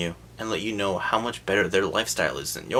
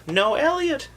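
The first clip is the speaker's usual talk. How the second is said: mock upset whine; 4 dB louder, slower than usual. Holly upset in the car.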